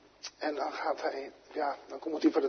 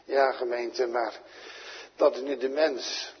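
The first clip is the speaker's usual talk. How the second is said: ordinary talk, nothing marked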